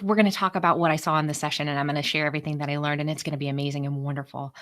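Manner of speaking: quick, hopeful tumble of words; naive rush. Retold or imitated. Rushing through her hopeful daydream about the meeting. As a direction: rattle it off, naive and eager. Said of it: "I'm thinking naively".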